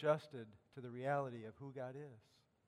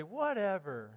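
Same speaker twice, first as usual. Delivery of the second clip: dismissive whatever; 5 semitones higher, 4 dB louder, slower than usual. Shown as a demonstration. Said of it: "I wanna say".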